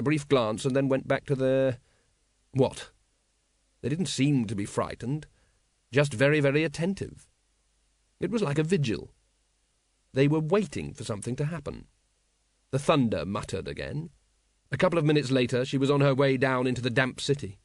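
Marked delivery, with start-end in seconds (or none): none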